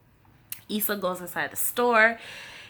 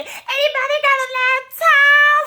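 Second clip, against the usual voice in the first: high squeaky put-on voice, louder; mock plea; 10 dB louder than usual. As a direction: beg for a laptop in a silly voice. Retold plea, high strange voice.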